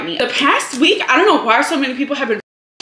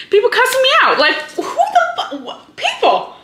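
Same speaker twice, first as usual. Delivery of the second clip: high outraged disbelief; 13 semitones higher than usual. Real, not acted. Outraged retelling of dog park fight.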